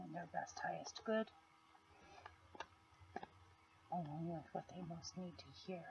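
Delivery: soft, near-whispered intro; leaning in to whisper. Real, not acted. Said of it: "I tend to whisper a little bit".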